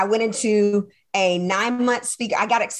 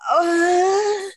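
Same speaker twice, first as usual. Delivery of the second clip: mock weary whine; 7 semitones higher than usual. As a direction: whine like a worn-out child begging for rest. Acted weary complaint to God.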